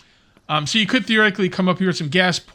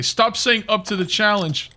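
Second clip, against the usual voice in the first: louder, higher, slow mock complaint; imitating annoyed viewers; 7 semitones higher, 5 dB louder, slower than usual. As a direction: ham up a viewer telling you off. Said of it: "Now people are gonna say".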